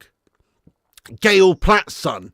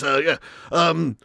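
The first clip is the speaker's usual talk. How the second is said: normal talking voice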